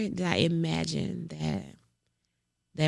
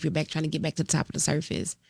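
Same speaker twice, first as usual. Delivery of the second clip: usual speaking voice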